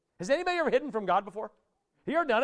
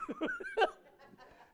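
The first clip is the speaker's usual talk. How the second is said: high laugh; 9 dB quieter than usual. Real, not acted. Laughs after "Whap!.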